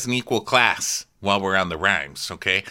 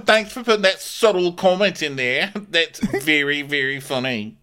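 squeaky high mock-offended voice; shrill laughing impression of Kyle; 13 semitones higher, 7 dB louder than usual. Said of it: "And Kyle's like".

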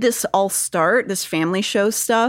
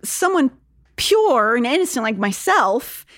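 high, wide-eyed innocent tone; naive innocent voice; 6 semitones higher than usual. Retold or imitated. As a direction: play it starry-eyed and naive. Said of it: "that idea is like".

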